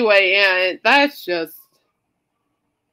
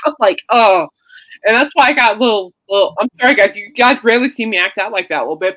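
real tears held back, louder and straining; fighting back tears while explaining to friends; choked up; 2 semitones higher, 7 dB louder than usual. Real, not acted. Apologizing to friends after tearing up, choked up. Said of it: "I'm trying not to cry".

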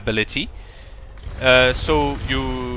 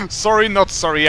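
laughing; 5 semitones higher than usual. Real, not acted. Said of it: "I love fooling around".

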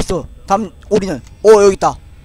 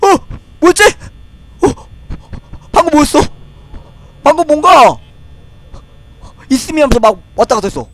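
shaken; 9 semitones higher, 4 dB louder than usual. Real, not acted. Shaken right after the scare.

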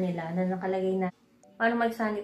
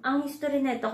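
usual speaking voice

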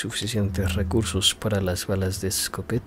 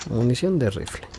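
ordinary talk, nothing marked

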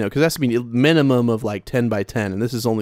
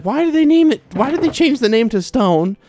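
much higher, half-joking exasperated rant; mock outrage; 8 semitones higher than usual. Minecraft boomer ranting to viewers about renamed blocks, mock outrage. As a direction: play up the indignation. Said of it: "I'm kinda halfway joking".

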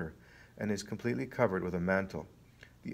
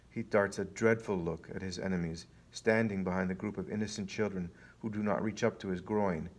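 ordinary delivery

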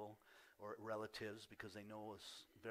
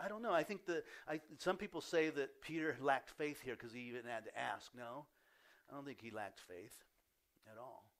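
normal talking voice

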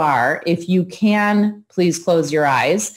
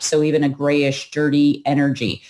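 usual speaking voice

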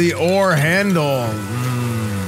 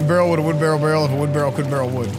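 fast tongue twister; 2 semitones lower, much faster than usual. Pretend. Rapid tongue twister about barrels.

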